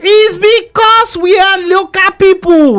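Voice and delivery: put-on high, drawn-out local Nigerian accent; exaggerated local accent. Jokey interjection about local speech.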